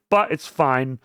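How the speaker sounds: ordinary talk, nothing marked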